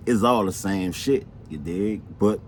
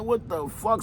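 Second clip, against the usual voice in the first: higher, softer mock alarm; retold startled reaction; 9 semitones higher, 6 dB quieter than usual. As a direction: recount a startled exclamation, pitched up. Retold shock at sudden gunfire.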